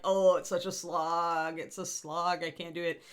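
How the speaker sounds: slow, drawn-out groan of a tired writer; mock weary whine. NaNoWriMo writer in week two, mock weary whine. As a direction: sigh through it like the plot is dragging. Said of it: "you're like".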